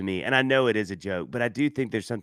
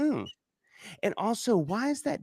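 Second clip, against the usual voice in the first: pitched-up doubtful hum into a question; high puzzled questioner; 11 semitones higher than usual. Retold or imitated. Future spouse doubting the family picture, high and quizzical. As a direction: sound quizzical and higher.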